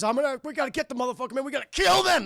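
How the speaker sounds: mock angry. Murtaugh urging Mel to kill them, mock-angry shouting. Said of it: "Murtaugh's pissed 'cause he's very angry".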